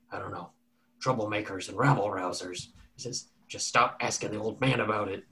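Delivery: soft confiding bartender; quiet warning to a customer; bartender hushed. Acted.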